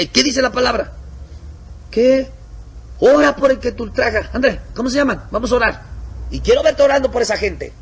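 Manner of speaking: high, loud commanding; bossy missionary. Acted. Missionary orders the brother to pray, high loud commands. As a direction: order him to pray now.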